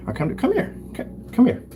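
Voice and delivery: high-pitched breathy coax; coaxing cat. Real, not acted. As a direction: coo at the cat to come over. Cooing cat to come.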